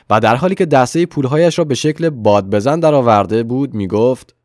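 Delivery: usual speaking voice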